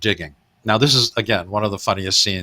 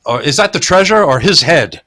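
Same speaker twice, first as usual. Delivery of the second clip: a touch louder, teasing; lightly voiced pirate jibe; mock pirate line; 5 semitones higher, 5 dB louder than usual. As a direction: tease like a pirate. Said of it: "funny dialogue".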